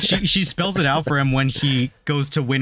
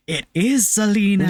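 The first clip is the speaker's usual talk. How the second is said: excited recognition; 7 semitones higher than usual. Retold or imitated. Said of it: "come back like".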